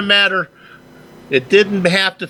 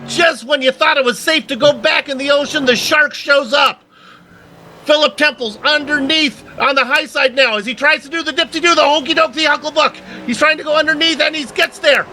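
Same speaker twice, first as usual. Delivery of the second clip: fast, raised race-caller voice; excited play-by-play; 10 semitones higher than usual. Acted. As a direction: call the final laps with rising excitement. Excited live race call.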